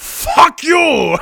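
high, full-volume comic scream; explosive mock-furious shout. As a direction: scream it as an outraged film star, half laughing. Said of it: "As I'm screaming".

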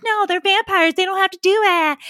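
high, loud mimicry of a dismissive friend; exaggerated mock protest; mock objection. Mimicking friend's objection in old argument.

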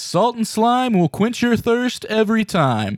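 sportscaster announcer. Acted. Mock sportscaster intro retake.

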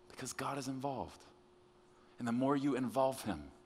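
soft, spaced-out, earnest delivery; hushed preaching. Real, not acted. Preacher reassuring those afraid of failing, hushed and earnest. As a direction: bring it right down, pausing between phrases.

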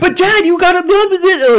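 high mock child babble; whiny kid. Kid pleading with dad on phone, mock high whiny voice. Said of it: "He's like".

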